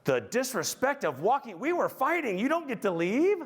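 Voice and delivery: mock petulant outrage; mock outraged whine. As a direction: play the offended husband, hammed-up. Voicing his outraged thoughts.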